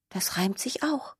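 soft, breathy whispered aside. Acted. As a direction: whisper it tenderly, a little skunk. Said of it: "unvoiced whisper".

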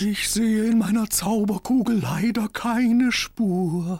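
put-on high, slow old wizard. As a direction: speak as the court wizard. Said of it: "meinte der Hofzauberer".

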